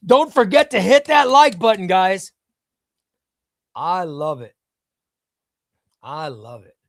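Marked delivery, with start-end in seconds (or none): 0.1-2.3 high, loud call-out; punchy, raised-voice plug; loud announcement. Retold or imitated. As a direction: belt out the reminder to the viewers. Calls out a viewer's reminder.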